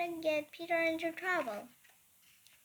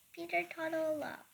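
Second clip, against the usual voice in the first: plain reading voice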